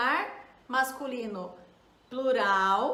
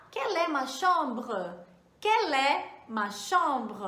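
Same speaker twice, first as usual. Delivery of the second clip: "Quelle est ma chambre?" said slowly and clearly; slow model phrase; slower than usual. Shown as a demonstration. Teacher models asking for a hotel room, slow and clear. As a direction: lay each word down slowly for the class. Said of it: "Olha lá".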